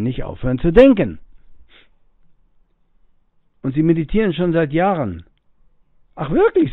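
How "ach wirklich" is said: high, loud feigned surprise; a playful, raised ach wirklich; mock surprise. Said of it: "sage ich".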